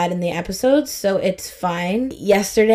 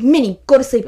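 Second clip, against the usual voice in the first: put-on higher, louder little-kid command; bossy toddler; 4 semitones higher, 5 dB louder than usual. Toddler to her Minnie Mouse, bossy command. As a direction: imitate a small child ordering a doll about.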